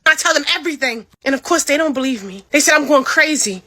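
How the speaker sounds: plain reading voice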